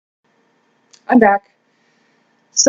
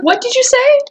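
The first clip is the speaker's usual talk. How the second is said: high-pitched repeated what; mock outraged squeaky questioning; indignant disbelief; 13 semitones higher than usual. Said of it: "I'd be like".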